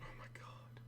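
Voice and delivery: breathless under-the-breath whisper; a stunned whisper to himself; whispered oh my god. Real, not acted. Poker streamer watching the hand, stunned whisper.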